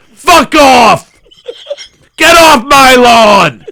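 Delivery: loud angry shout. Real, not acted. Shouting to end rant.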